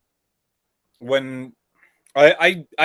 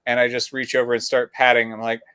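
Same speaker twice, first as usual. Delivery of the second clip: ordinary talk, nothing marked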